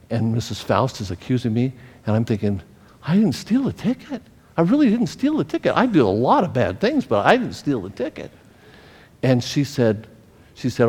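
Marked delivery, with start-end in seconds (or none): 3.1-8.3 put-on high, pleading child's voice, insisting; a boy desperate to be believed; pleading innocence. Child pleading innocence, inner thought.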